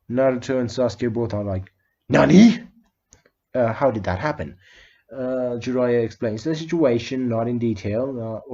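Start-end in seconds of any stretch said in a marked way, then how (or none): 2.1-2.6 loud, high "Nani?" of disbelief; shocked outburst. Retold or imitated. Naruto and Sasuke hearing the Hokage is dead, loud shocked cry. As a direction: blurt it out in shock.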